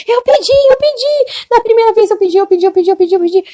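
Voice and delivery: high, loud, insistent repetition; begging to be believed; pleading young woman. Retold or imitated. Young woman insisting to the angel, high and loud.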